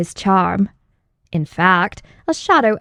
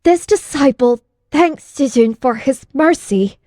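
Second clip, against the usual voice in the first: shocked and shaky; 5 semitones higher than usual. Acted. Disciple thanking his master after being thrown back, shaken. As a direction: thank him while still rattled. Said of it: "still a bit shocked and shaky".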